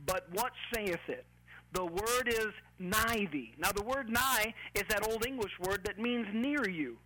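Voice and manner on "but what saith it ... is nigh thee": deliberate scripture reading, words drawn out; slow reading. Shown as a demonstration. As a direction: read the verse slowly. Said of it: "look at this again slowly".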